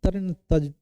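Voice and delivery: ordinary talk, nothing marked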